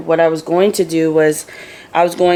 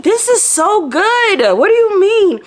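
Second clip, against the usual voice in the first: much higher, slow, gushing disbelief; slower than usual. Retold or imitated. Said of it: "I'm like".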